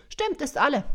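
high, loud confirming voice; Santa's wife. Acted.

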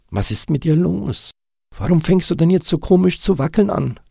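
deutlich höher, schnell und besorgt. Acted. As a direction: frag hastig und voller Sorge. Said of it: "fragte er ihn besorgt". (Kass fragt den zitternden Nick besorgt)

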